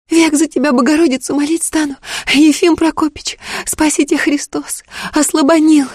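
put-on high, breathless, overjoyed girl. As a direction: gasp it out in tearful joy. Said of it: "задыхаясь, шептала она".

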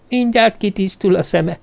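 high, squeaky little creature voice. Acted. The stranger reassures Jervis his eyes will adjust, high and squeaky. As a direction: keep the odd squeaky tone of the small stranger. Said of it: "nyekeregte a hang".

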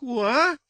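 high-pitched imitated newborn cry; baby's squeal. A narrator's reading. Baby cries in the dust at his feet, squeal. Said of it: "послышался у его ног слабый писк".

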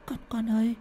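high, stammering, trembling whispered question; frightened whisper. Acted. Old woman nervously asking why her daughter-in-law's hand is cold. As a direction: whisper it haltingly, scared of the answer. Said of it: "làm cho mụ Lý chột dạ".

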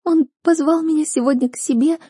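high, tearful voice; sniffling. Acted. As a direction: say it through sniffles. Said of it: "всхлипывала Рейлин".

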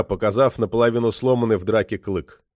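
plain reading voice

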